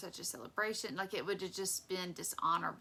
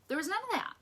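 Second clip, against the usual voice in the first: high, loud emphatic denial; 11 semitones higher, 4 dB louder, faster than usual. Real, not acted. Preacher insisting to congregation, high and emphatic. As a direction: fling it up high in disbelief.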